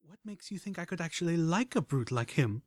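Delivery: soft, different-timbre mare voice. A narrator's reading. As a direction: voice the other mare lightly. Velvet Remedy's voice.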